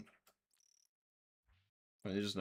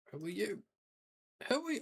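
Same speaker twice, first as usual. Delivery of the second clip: mock escalating squeak; 10 semitones higher, faster than usual. Repeated NPC greeting, rising pitch.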